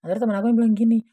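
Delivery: ordinary delivery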